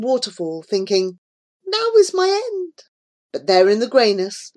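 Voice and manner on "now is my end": high-pitched cry of doom; high, resigned despair; despairing realisation. A narrator's reading. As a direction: say it facing certain death. Hero's thought facing death at waterfall.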